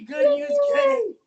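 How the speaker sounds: high excited cheer; excited shout. Acted. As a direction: shout it out happily. Cheering on cue.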